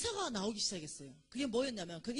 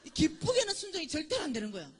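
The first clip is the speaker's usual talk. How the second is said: laughing; 7 semitones higher, 10 dB louder than usual. Real, not acted. Laughing at own grudging obedience.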